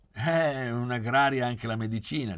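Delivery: a bright, lightly merry retort; cheerful. A narrator's reading. Oreste cheerfully answering his father about studying medicine, mild cheer. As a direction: toss it back with easy good humour.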